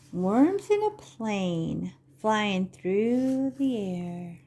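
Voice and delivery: slow, sing-song picture-book reading; Vorlesen. Acted. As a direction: read to little children, stretching the words with big pauses. Reads picture book to children.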